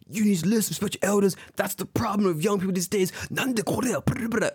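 high-pitched ranting salaryman; high, hectoring tirade in mockery; mock screaming rant. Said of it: "like, screaming, he's like".

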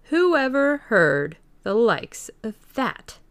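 mock the very idea, countryman style; scoffing villager. Villager Huckster scoffing at the invisible stranger's claim, slow rustic disbelief.

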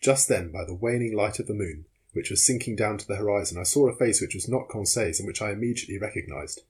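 ordinary delivery, nothing marked

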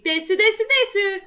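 a hint of a cutesy anime girl; kawaii girl. Mocking an overly sweet shopper, lightly cutesy. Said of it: "like a Japanese kawaii girl".

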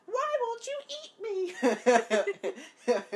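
very high, drawn-out wail of a cartoon muffin; stretched, high-pitched muffin cry. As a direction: plead loudly in a squealing muffin voice. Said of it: "you're that muffin from".

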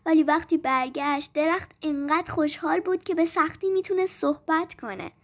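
ordinary delivery, nothing marked

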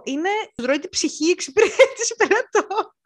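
a real laugh breaking into the line; laughing through it. Real, not acted. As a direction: laugh the words out as you vent. Laughing while complaining about customers.

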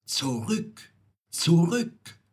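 warning call that climbs on the repeat. A narrator's reading. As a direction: call back, building from measured to urgent. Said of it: "Die Nachtigall ruft".

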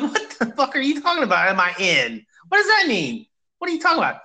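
high, baffled outburst, pitch climbing; shocked and confused, voice jumping up; incredulous. Retold or imitated. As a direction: act stunned by a nonsense question. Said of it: "And I'm like".

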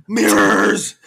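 high, loud drawn-out cry; hammed-up shout of revelation; mock dramatic cry. [name] announces her vision, mock dramatic cry. [name] cries out in acted recap.

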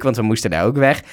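normal talking voice

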